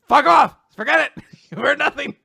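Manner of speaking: high, loud comic shouting; hammed-up defensive outburst; mock furious denial. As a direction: yell it in flustered mock anger. Acted flustered angry denial.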